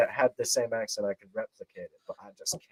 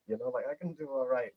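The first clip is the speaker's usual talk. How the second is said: ordinary delivery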